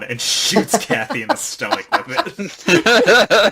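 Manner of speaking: can't keep it together at the twist; laughing in disbelief. Real, not acted. Host recapping the gunshot twist, breaking into laughter.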